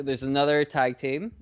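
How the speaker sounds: normal talking voice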